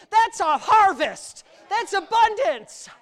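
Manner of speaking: slow, high, very loud exclamation; triumphant shout. Real, not acted. Preacher proclaims the full ships a harvest, triumphant shout. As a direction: hammer each phrase out at full preaching force. Preacher climaxes on the miracle catch.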